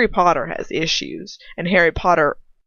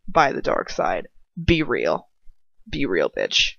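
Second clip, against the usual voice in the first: low, mocking repeat of the line; mocking deep voice; 11 semitones lower, 3 dB quieter than usual. Reader mocking the fanfic's dialogue, in a low sneering voice. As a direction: sneer it back in a much lower voice.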